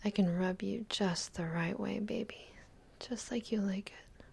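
ordinary talk

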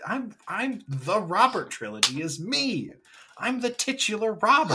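high, hammed-up protest; mock indignant star. Host voicing an affronted actor, in high mock indignation. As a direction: pitch it way up, pretend outrage. Voices indignant Hanks boasting credits.

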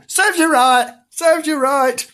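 high sing-out jeer; mocking high-pitched gloat; taunting. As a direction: crow it gleefully at the loser. Frank taunts the gardener.

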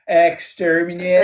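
comic Dalek with a Belfast twang; Northern Irish Dalek. Acted. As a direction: chant it syllable by syllable. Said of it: "do my Northern Irish Dalek".

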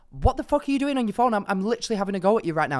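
imitating an outraged shout at him; retold angry yell. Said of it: "So I'm shouting at him".

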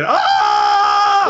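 high, loud mock scream as he flies back; hammed-up falling villain's scream. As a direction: yell it like a villain blasted backwards. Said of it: "bara skriker".

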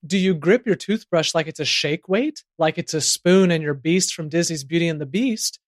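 ordinary talk, nothing marked

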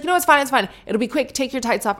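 high, loud, pushy dismissal; bullyish. Retold or imitated. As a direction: imitate a salon worker hustling a customer along. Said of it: "they're quite bullyish".

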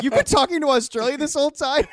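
high, laughing disbelief; can't believe what you heard; incredulous. Retold or imitated.

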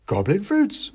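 high cheerful squeak; happy mouse seller. Acted. The mouse happily names his ingredients. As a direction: answer brightly like a pleased mouse. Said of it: "nodding happily".

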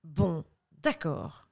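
slow hushed crime documentary voice; lightly voiced true crime host; true crime narrator. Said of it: "la façon faites entrer l'accusé".

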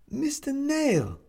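high, slow drawn-out call. A narrator's reading. Neighbour calling for him from the hallway, drawn out. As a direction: call his name through the door.

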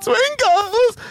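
very high, drawn-out mock wail; horrified wail. Woman opens pizza box on her dead cat, mock horrified wail. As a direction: imitate a cat owner wailing in grief.

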